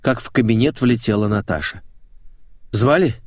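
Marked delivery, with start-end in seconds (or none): 2.7-3.1 higher, lightly winded query; a touch of breathlessness from hurrying; slightly out of breath. Acted. Employee arriving at the boss's office, slightly winded. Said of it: "слегка запыхавшись, спросила она".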